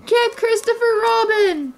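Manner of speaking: high, slow pleading shout; desperate call. A narrator's reading. Tigger stuck in the tree crying out for Christopher Robin. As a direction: plead for rescue, drawn out.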